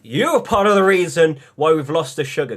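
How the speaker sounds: loud, high heated rant. Real, not acted. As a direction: rant at them. Heated rant, much louder.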